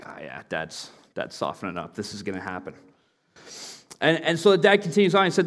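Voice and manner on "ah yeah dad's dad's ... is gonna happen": low, soft scheming murmur between brothers; hushed conspiratorial aside. Retold or imitated. Brothers murmuring to each other that dad is softening, hushed. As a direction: whisper it like you're plotting.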